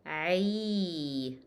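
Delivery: "ay" held long, not a bare e; Arabic ain sliding into a long ee, "ay"; ain with kasra. Shown as a demonstration. Teacher corrects "not e", long ay. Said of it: "Not e, by the way".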